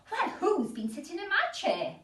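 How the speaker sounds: a cross mother bear asking; Mummy Bear. Acted. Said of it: "said Mummy Bear".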